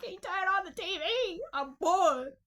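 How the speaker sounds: high, loud whiny plea; hammed-up begging, ending in a loud complaint. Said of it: "so I'm begging this motherfucker".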